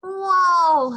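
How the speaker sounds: loud, high gasp of wonder; be blown away by what you see; amazed whoa. Acted. Skipito stepping into his closet jungle, loud awed whoa. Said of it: "said Skipito".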